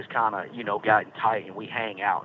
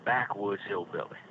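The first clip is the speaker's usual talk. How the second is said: ordinary talk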